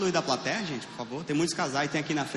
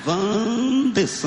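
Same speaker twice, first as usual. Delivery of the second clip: slow, loud stretched Wanderson; drawn-out name; 5 semitones higher, 6 dB louder, much slower than usual. Real, not acted. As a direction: stretch the name to tease him. Drawling name at audience member. (nome bem arrastado e alto, provocando)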